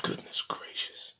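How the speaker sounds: breathless whispered goodness gracious. Real, not acted. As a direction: say it under your breath. Aside after a pause.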